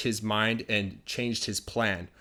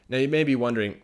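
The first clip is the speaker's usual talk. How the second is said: ordinary talk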